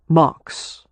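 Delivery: a slightly unsteady "Marks"; a lover's name said unevenly. A narrator's reading. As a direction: let the name come out uneven. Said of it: "his voice uneven".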